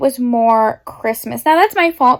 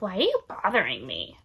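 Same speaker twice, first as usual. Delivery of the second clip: voicing the dog; 4 semitones lower, 11 dB quieter than usual. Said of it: "He's like".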